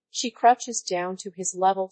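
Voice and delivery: ordinary delivery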